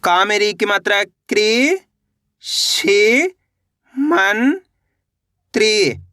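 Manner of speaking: very slow, each syllable drawn apart. Shown as a demonstration. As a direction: spell it out slowly, piece by piece. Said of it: "पहले गृह पढ़ो". (अक्षर-अक्षर धीरे-धीरे)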